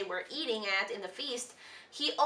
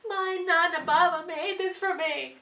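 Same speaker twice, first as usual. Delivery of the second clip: higher, louder hurt little prince voice; offended prince; 6 semitones higher, 4 dB louder than usual. Retold or imitated. Prince answering the troll's insult, hurt and higher. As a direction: whine it like the insult stung. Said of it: "saying, like".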